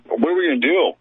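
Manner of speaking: ordinary talk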